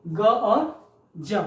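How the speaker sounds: ग और ज drawn out slowly and clearly; sounds of G. Shown as a demonstration. Teacher repeats the two sounds of G for the class, slowly. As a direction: say ग and then ज, slowly, each sound set apart. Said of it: "दो उच्चारण होता है".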